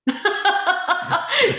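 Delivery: a quick, high real laugh. Real, not acted. Dog trainer laughing at her own point, high laugh.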